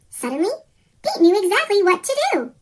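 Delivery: usual speaking voice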